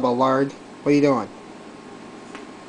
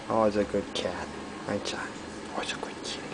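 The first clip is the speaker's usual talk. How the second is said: soft cooing; 4 semitones lower, 9 dB quieter, faster than usual. Real, not acted. Cooing softly to the cat.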